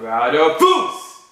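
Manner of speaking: loud, drawn-out battle cry; shout. Acted. Player mimics the character's forward-throw yell, shouting. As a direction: belt it out as a drawn-out attack shout. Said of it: "he would shout".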